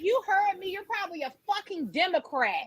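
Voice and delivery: imitated upset customer. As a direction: act out an irate caller, lightly. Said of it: "He was so upset".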